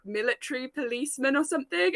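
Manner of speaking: ordinary delivery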